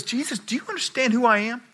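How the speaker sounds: a governor losing patience, rushing his threat; uptight Pilate. Retold or imitated. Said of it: "Pilate gets all uptight and he goes".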